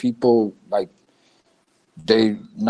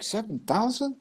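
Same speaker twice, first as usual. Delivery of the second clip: incredulous question; 8 semitones higher, 7 dB quieter than usual. Retold or imitated. Retold disbelief at dialect count.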